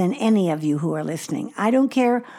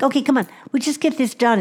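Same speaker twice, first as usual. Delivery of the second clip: fast, higher mock rushing; hurried impatience; 6 semitones higher, faster than usual. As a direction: rattle it off to get through it. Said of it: "You talk too fast".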